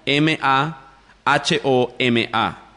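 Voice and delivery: slow letter-by-letter M A H O M A; spelled out. Shown as a demonstration. Teacher spells the author's name for listeners, spelled out. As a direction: give each letter its own beat, slowly. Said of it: "se escribe".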